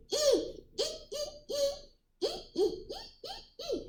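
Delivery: tiny, very high monkey ee-ee; monkey squeaks. A narrator's reading. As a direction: squeak softly like a cheeky monkey. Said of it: "monkeys only shook their fists back".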